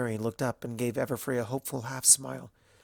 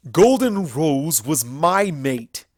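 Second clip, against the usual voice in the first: loud, slow stallion voice; slow, weighty angry claim; angry declaration; 4 semitones higher, 11 dB louder, slower than usual. A narrator's reading. Stallion insisting to the librarian, slow and angry. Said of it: "confusion and anger".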